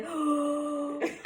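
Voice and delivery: long, mock-horrified gasp; acted gasp of horror; shocked gasp. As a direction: suck in air in retold shock. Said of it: "and I freaked out".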